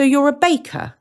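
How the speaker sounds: put-on curious young boy voice; a hint of a boy asking; Hansel. Hansel voiced in read story.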